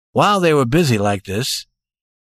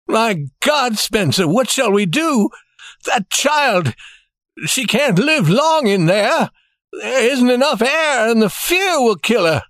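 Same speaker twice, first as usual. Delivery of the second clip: higher, anxious pleading; old banker begging for help; despairing old banker; 10 semitones higher than usual. A narrator's reading. Mister Adams in despair.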